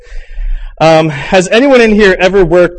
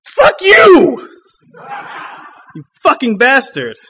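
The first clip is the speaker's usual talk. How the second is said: defiant shout; 9 semitones higher, slower than usual. Acted. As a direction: shout it like a release, then laugh it off. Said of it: "for us to sing".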